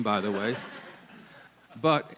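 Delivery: ordinary delivery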